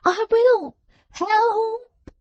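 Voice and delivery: put-on very high, desperate girl's voice; acted high-pitched cry for help; struggling cry for help. A narrator's reading. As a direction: call out for rescue as you struggle. Struggling girl cries for help.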